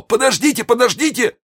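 high, frightened cry; wounded bandit crying out in fear; cry of fright. Acted. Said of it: "вскричал он от испуга".

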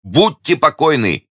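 high, slow, emphatic assurance; confident hero's high declaration; exclaiming. A narrator's reading. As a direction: promise it boldly, stretching the words. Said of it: "воскликнул Д'Артаньян".

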